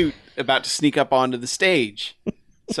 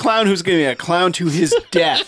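laughing; 5 semitones higher, 4 dB louder than usual. Real, not acted. Laughing at the clown.

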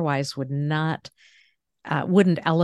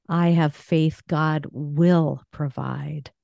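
slow phrase, punching "will"; stress on WILL; slower than usual. Shown as a demonstration. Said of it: "here's a positive faith affirmation".

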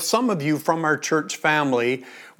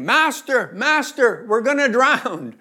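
raised higher, pleading cry for help; mild mock shout; 5 semitones higher than usual. A narrator's reading. Disciples waking Jesus in the storm, higher mock shout. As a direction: call out as disciples fearing they'll drown.

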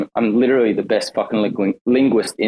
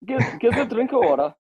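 high, amused mimicry of British dialect; playful British accent imitation; mimicked British dialect; 12 semitones higher than usual. Mimics speaker's dialect phrase.

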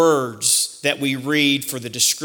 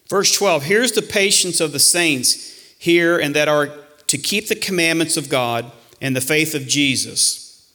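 normal talking voice

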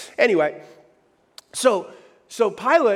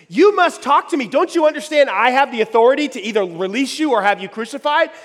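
stern demand; 5 semitones higher, 7 dB louder than usual. Acted. Pilate demands Jesus answer.

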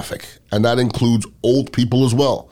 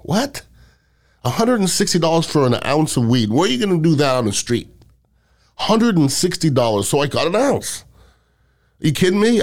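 higher, incredulous "What?" then mock outrage; retold disbelief; 5 semitones higher than usual. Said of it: "And I go".

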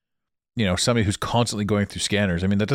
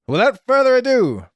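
much higher and louder showman announcement; big announcer build; 14 semitones higher, 6 dB louder than usual. Acted. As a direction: launch it like a game-show host. Host launches into the topic.

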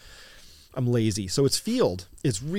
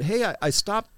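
put-on higher-pitched, amateur Irish accent; bad Irish accent; 8 semitones higher than usual. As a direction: imitate an Irishman with a thick, clumsy brogue. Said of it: "bad accent, but that was him".

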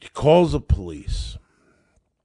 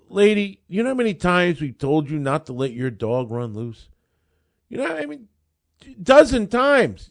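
scolding cop; 10 semitones higher, 7 dB louder than usual. Retold or imitated. Officer scolding the dog owner.

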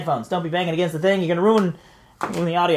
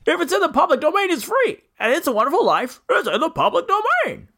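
higher, earnest Jimmy Stewart voice; George Bailey impression; 7 semitones higher than usual. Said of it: "That impression just fell apart".

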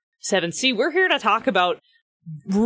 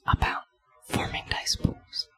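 gentle whisper; 7 dB quieter than usual. Pretend. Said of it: "Whisper gently".

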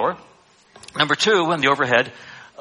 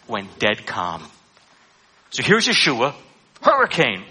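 normal talking voice

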